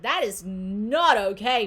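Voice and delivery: loud, drawn-out disapproval; emphatic indignation. Acted. Realizing family treatment is wrong, emphatically indignant. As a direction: lean slowly and loudly on each word.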